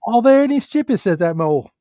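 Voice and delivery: put-on high-pitched, botched Australian accent; terrible Australian accent. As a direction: mimic an Australian and slide into Cockney. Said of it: "that was a terrible Australian accent".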